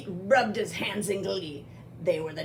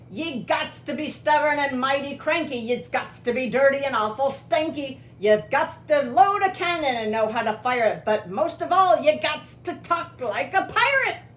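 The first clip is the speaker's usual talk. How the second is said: higher, hearty pirate talk; rollicking, rhythmic pirate declaration; pirate captain Pete; 5 semitones higher, 4 dB louder than usual. Acted. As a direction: rattle off the rules as a pirate captain. Pete lists pirate rules.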